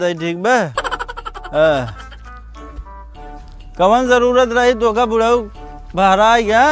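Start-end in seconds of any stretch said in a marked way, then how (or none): none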